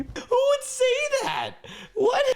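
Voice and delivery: very high, disbelieving shriek; shocked falsetto who would say that; incredulous squeal. Real, not acted. Outraged at creepy text.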